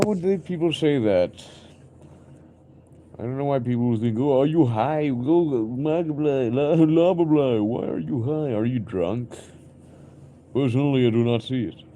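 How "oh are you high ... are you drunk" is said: loud, high mocking voice of doubters. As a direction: sneer like people calling him high. Mocking people who think he's high.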